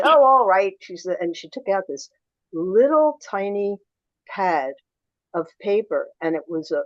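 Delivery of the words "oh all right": loud, higher-pitched grudging concession; gruff. Retold or imitated. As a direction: give in gruffly, at full volume. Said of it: "She was very gruff".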